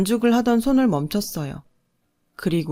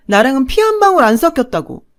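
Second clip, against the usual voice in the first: fast, loud, higher, hitting 방울; cruel shout; 6 semitones higher, 7 dB louder, faster than usual. A narrator's reading. Stepmother shouting there is no shared blood, fast cruel shout.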